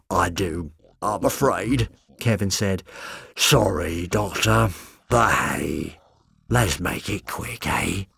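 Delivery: low, soft, regretful monster voice; a gentle beast sorry for what it must do; apologetic beast Kevin. A narrator's reading. Kevin the beast apologising to the Doctor, low soft regret.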